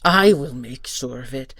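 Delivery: defiant vow. Acted. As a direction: declare it hard and proud. Guardian vows defiantly.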